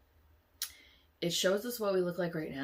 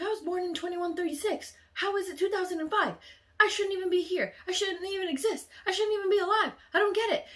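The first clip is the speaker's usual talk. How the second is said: put-on much higher and louder girl's voice, ending in a laugh; panicked disbelief; 14 semitones higher, 8 dB louder, faster than usual. Other girl realising she was born in the future, panicked. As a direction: spill it out in rising panic.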